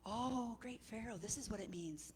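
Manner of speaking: lightly voiced soft courtier; hint of a deferential adviser, hushed; wise man. Wise men take turns answering.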